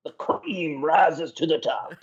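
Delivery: slow drawn-out promo voice; slow, heavy wrestler catchphrase; wrestling promo. Pretend. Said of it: "Cut a promo?".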